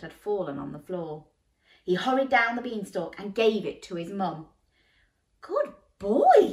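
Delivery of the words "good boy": high loud breathless praise; gasping praise. Acted. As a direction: gasp it out with delight. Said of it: "she gasped".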